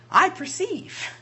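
high, drawn-out dawning realization; acted realization. Preacher acting Peter as the Holy Ghost falls, dawning realization. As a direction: act out a slow revelation. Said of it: "And he said".

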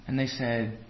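ordinary talk, nothing marked

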